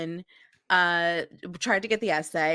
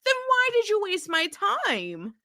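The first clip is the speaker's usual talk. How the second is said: higher, fed-up comeback; exasperated retort; 12 semitones higher than usual. Retold or imitated. Answering an advice-ignoring friend, exasperated and higher. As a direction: throw the question back, annoyed. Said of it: "And I'm like".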